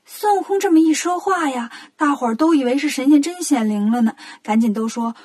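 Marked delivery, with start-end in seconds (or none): none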